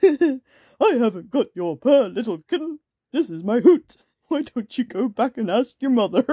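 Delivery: hammed-up owl character; wise old owl. A narrator's reading. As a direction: answer as a storybook owl. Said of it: "think of a voice for all of these characters".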